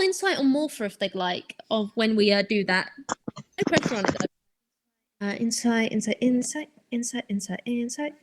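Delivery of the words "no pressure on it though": ordinary talk, nothing marked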